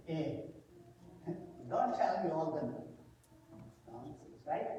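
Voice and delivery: scoffing disbelief. Acted. Thomas dismissing the disciples.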